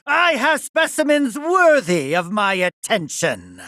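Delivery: high, gloating scientific triumph; triumphant exultation. Acted. Scientist finds specimens worthy of his attention, exultant.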